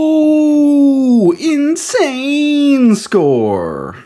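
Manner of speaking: high, loud, drawn-out "whoa"; hammed-up shock at the rating; mock amazement. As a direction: pretend to be blown away.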